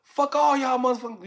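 high-pitched retold insult holler; imitated loud-mouthed dissing. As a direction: hurl it as a gang insult. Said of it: "He, he, he dissing".

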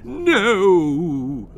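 loud high cry of horror; anguished no. Acted. Father horrified by the headache, loud cry. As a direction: cry out as if struck.

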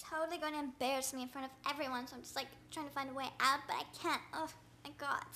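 normal talking voice

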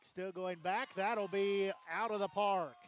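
loud, excited play-by-play; excited home-run call. Real, not acted. Sportscaster calling a homer over the center field fence, excited.

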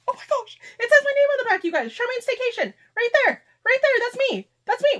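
high, loud squealing excitement; ecstatic. Real, not acted. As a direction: show it off, squealing with joy. Shows own name on gift.

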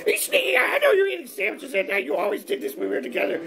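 high falsetto scolding; nagging ex-wife. Acted. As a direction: nag him in a put-on woman's voice. Ex-wife appears on the monitor.

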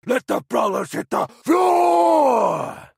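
normal talking voice

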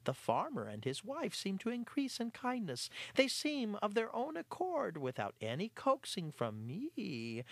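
the cat bragging to the mice; smug cat voice. Acted. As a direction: boast innocently. Clarence voiced.

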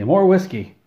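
high, loud surgeon's shout; shouted order. Acted. Surgeon demands whiskey for his groaning patient, shouted order. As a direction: call it out sharply over the groaning.

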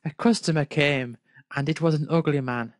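ordinary talk, nothing marked

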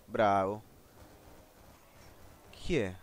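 ordinary talk, nothing marked

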